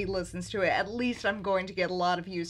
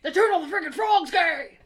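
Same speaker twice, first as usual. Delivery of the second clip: Alex Jones impression; 8 semitones higher than usual. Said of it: "that Alex Jones".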